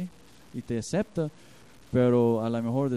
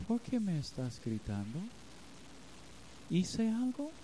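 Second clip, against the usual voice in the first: quiet, tender question, higher at the end; soft and gentle; 6 semitones higher, 8 dB quieter than usual. Retold or imitated. Wife gently asks her angry husband, softly hurt. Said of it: "con un tono así, blandita".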